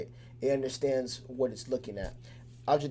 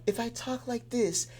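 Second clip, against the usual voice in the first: higher put-on demo voice; a higher, lighter vibe demo; different vibe; 9 semitones higher, slower than usual. Shown as a demonstration. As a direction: pitch it up into another character to show a new vibe. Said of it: "you kinda get different vibes".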